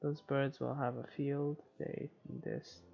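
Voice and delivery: usual speaking voice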